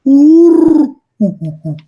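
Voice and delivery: high, loud imitated hoot; owl hoot. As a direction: hoot like an owl in the dark woods. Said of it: "I hear".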